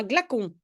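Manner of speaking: "glacon" said with a hard K, gla-KON; wrong pronunciation. Shown as a demonstration. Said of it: "sinon c'est un glacon".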